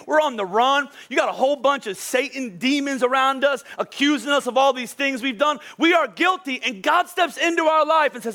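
fervent preacher in full flow; impassioned preaching. Real, not acted. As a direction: preach it with rising fervour to the congregation.

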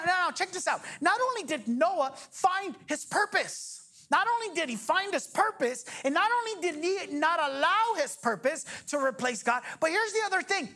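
ordinary delivery

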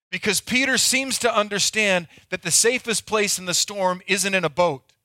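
ordinary talk

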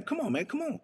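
soft, high-pitched pleading; imitated gentle begging. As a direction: coax him in a small high voice. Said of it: "his father was begging him".

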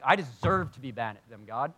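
a little indignant. Acted. Preacher imitates himself complaining to God, a little indignant. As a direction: protest with mounting righteous grievance. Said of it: "I get a little indignant".

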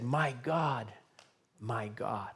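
a hushed cry of anguish; quiet anguished cry. Retold or imitated. Preacher voicing Jesus on the cross, hushed and anguished.

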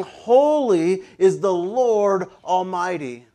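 slow, loud proclamation; emphatic angelic call, drawn out. Acted. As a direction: declare it with stretched, weighty words. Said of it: "content of their call out".

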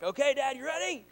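high, loud eager call; psyched up on the couch; little wrestler calling out; excited kid. Retold or imitated. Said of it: "And I was like".

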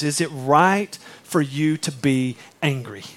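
slow, heavy rhetorical question; slow quoted question. Shown as a demonstration. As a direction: let the question hang slowly. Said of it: "and he goes".

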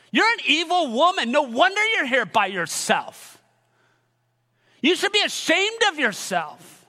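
hammed-up, scandalised scolding; mock condemning. Imagined accuser shaming the woman at the well, mock condemning. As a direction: act out an indignant accuser, drawing out the outrage. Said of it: "Jesus is not condemning her".

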